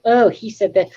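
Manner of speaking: high, sneering gotcha voice; high mock-accusing commenter voice. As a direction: mimic a smug online commenter, pitched high. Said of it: "they're gonna go".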